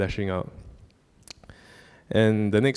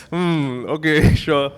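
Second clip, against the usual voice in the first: awkward laughing agreement; 6 semitones higher, 6 dB louder, much slower than usual. Retold or imitated. Father says son needs crying.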